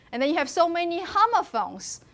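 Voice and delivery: ordinary talk, nothing marked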